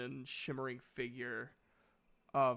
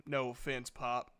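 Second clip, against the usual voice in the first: ordinary delivery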